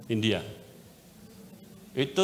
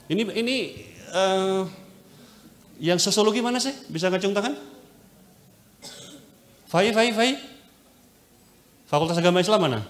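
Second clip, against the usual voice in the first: calling out; 7 semitones higher, faster than usual. Real, not acted. Calling out to the class.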